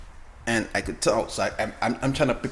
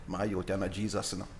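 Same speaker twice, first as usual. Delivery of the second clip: lower, softer Jamaican patois; Jamaican yard accent; 4 semitones lower, 6 dB quieter than usual. Retold or imitated. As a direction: scold in a light Jamaican accent. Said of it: "my yard accent".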